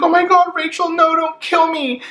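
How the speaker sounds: very high, hammed-up terrified shriek; over-the-top horror-movie victim; mock scream. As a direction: shriek as if a knife is coming at you. Knife-wielding attacker flying at her.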